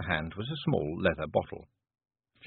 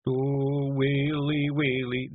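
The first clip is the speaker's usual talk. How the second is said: higher, milder comic keening; starting a wail; 6 semitones higher, much slower than usual. A narrator's reading. Simpleton starting to mourn before being hushed, mild wail. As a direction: begin to moan mournfully in Scots. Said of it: "Daft Woolly began".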